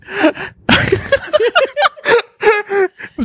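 high wheezing laugh; cracking up. Real, not acted. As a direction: burst into helpless high laughter. Cracks up mid-story.

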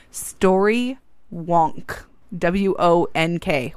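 "Story Wonk" drawn out very slowly, then spelled. Shown as a demonstration. Host spelling out a hard-to-catch podcast name, slowly. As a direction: say "Story Wonk" word by word, letters spaced out. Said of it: "I'm gonna say this slowly".

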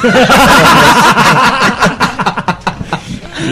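loud burst of laughter; big laugh. Real, not acted. Group laughs at band joke.

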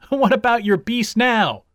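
high, loud jeer; taunting call. A narrator's reading. Hare's friends to the backers of the tortoise, taunting. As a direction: crow at the rival side.

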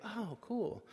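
high, soft little reaction; light, high-pitched acceptance; mild pleased surprise. Retold or imitated. Brothers' relieved reaction acted out.

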